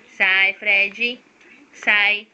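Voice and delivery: low, loud command to a dog; stern shooing. Real, not acted. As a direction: order the pet away firmly.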